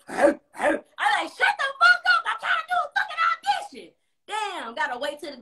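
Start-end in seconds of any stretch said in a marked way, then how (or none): 0.1-3.9 hammed-up yell at a barking dog; mock yell at the dog. Yelling at the neighbour's dog during an audition, high mock shriek. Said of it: "I like".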